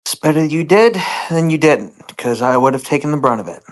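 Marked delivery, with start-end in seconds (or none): none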